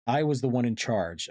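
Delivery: plain reading voice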